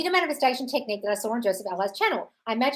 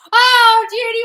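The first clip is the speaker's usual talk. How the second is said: gushing delight; 12 semitones higher, 12 dB louder, much slower than usual. Real, not acted. Gushing over a viewer's good news.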